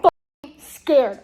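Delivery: loud and slow, scared; loud dramatic fright; loud mock fear. Real, not acted. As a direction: wail your fear of the book. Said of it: "I'm so scared".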